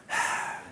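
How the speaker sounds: acted fed-up exhale; exasperated sigh. Late partner answering the nagging, exasperated sigh. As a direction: let out an eye-rolling sigh. Acting eye-rolling reply to spouse.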